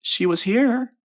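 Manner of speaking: put-on very high, loud boy; high-pitched boyish answer; boy's voice. A narrator's reading. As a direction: pipe up like a child in the dark. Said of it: "said a boy".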